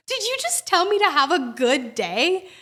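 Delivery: hammed-up offended question; lightly voiced scandalised elderly woman; indignant old woman.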